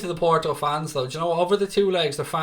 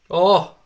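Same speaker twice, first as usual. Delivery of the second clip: loud, short exclamation; sudden loud "oh"; 3 semitones higher, 8 dB louder than usual. Real, not acted. Football watcher reacting to play on screen, sudden loud exclamation. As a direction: burst out at the action.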